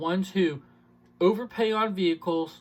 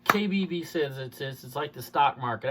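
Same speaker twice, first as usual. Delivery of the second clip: normal talking voice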